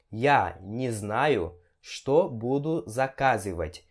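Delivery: slow, phrase by phrase with pauses; slow teaching repeat. Shown as a demonstration. Teacher repeats a Russian example for students, slow and separated. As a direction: spell it out slowly for learners.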